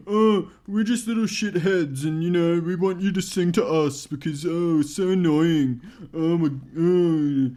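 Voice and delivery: whiny, drawn-out mock-child complaint; kids' voice. Imitating kids demanding to be sung to, whiny child voice. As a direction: whinge it slowly like a brat. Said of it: "just the way that kids speak".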